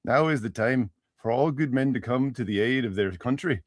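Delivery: put-on robotic machine voice with an Irish accent; a typewriter speaking in robotic Irish. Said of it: "It's robotic and Irish".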